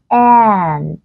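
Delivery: AND with a tense, nasalized a, half a half e; căng, hơi mũi. Shown as a demonstration. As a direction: say AND with the vowel pulled tight toward e. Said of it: "mình đọc nó nửa a nửa e". (AND âm a căng, hơi mũi)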